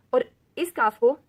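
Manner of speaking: usual speaking voice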